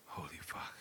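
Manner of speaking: soft stunned whisper. Real, not acted. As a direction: breathe it out in a whisper. Recovering from sour candy.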